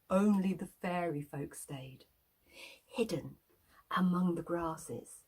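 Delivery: ordinary delivery, nothing marked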